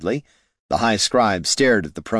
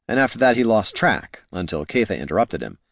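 ordinary delivery

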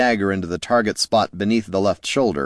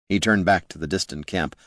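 usual speaking voice

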